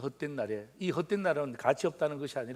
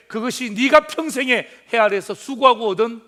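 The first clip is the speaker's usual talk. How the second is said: louder and higher, proclaiming the verse; emphatic proclamation; 6 semitones higher, 12 dB louder than usual. Acted. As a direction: announce it to the whole church. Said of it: "preacher proclaims verse". (힘차게 말씀 선포)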